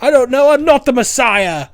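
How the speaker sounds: insist, exasperated and loud; exasperated Brian. Retold or imitated. Brian denying he is the Messiah, in a high fed-up voice.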